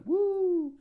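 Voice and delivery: very high, loud "woo"; imitated crowd cheer. Crowd answers the music question, mock cheering. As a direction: whoop like a whole audience.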